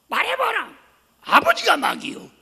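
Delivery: high, loud yell; shouting back. Retold or imitated. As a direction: yell it right in his face. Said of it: "마귀라고 소리 질렀다가".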